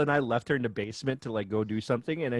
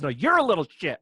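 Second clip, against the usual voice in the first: louder, higher sassy retort; sassy comeback; 4 semitones higher, 8 dB louder than usual. Retold or imitated. Child throwing the insult back at mom, sassy. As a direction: fire it right back. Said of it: "She goes".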